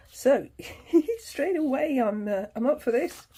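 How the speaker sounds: real burst of keen excitement; a little bit excited. Real, not acted. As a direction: pitch lifting in giggly eagerness. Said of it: "got a little bit excited there".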